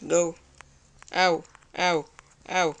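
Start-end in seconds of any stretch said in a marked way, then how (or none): none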